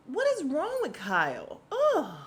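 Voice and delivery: high, loud, fed-up outburst ending in a groan; exasperated rant ending in a disgusted ugh; real exasperation. Real, not acted. Ranting to viewers about Kyle, really exasperated. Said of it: "I am super pissed off".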